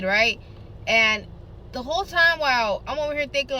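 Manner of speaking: ordinary talk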